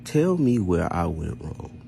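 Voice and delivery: normal talking voice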